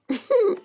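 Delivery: sudden high laugh; burst of laughter. Real, not acted. Streamer laughing at her own story, high burst of laughter.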